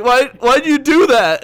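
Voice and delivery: very high, loud, slow retold wail; exasperated protest. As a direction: act out dismayed scolding at full voice. Acts out upbraiding his roommate.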